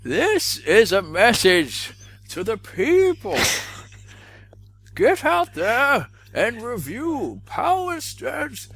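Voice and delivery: slow, high villain voice; Bane voice. Retold or imitated.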